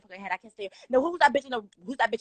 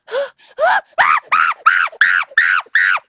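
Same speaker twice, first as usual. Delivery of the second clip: high, loud screaming gasp; loud shocked gasp; 13 dB louder than usual. Real, not acted. Seeing a big guest on screen, a loud shocked gasp.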